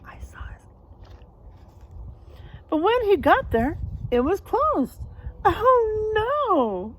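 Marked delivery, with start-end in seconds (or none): 0.0-0.6 whispered secret aside. Real, not acted. Reader whispers to the children about the picture. 5.5-7.0 higher, louder wail; crying out. Acted. George finds the shop closed and cries out. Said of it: "cried George".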